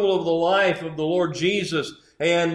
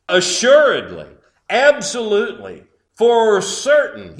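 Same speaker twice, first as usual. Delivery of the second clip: building emphasis; 4 semitones higher, 6 dB louder than usual. Acted. Peter's climax, building emphasis.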